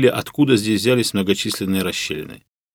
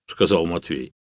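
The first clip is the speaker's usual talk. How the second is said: ordinary delivery, nothing marked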